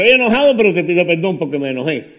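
loud, high cry of estoy enojado, then calmer apology; honest angry admission. Shown as a demonstration. Pastor showing the congregation the honest way, loud and high. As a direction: blurt the anger out, then ask pardon. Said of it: "A decir".

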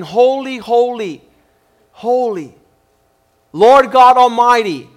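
building proclamation, rising to loud; angels crying out. Retold or imitated. Preacher quotes angels crying day and night, proclaiming. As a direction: proclaim it, ending strong on ALMIGHTY. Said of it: "these angels crying".

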